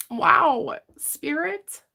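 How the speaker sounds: a high, catching "Wow, Spirit" as emotion rises; overwhelmed, voice climbing high; emotional. Real, not acted. Reader caught off guard by emotion mid-reading. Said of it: "Spirit's making me all emotional today".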